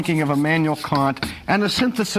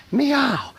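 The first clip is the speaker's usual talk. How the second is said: much higher, playful meow; cat meow; 11 semitones higher than usual. Retold or imitated. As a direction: mew like a kitten for a child. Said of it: "what does the cat say".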